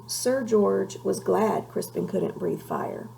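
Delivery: ordinary delivery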